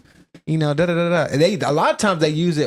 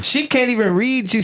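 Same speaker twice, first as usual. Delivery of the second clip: high, acted-out plea; put-on lovelorn pleading; mock pleading; 10 semitones higher than usual. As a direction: plead the case in a raised pitch. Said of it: "she's pleading to him".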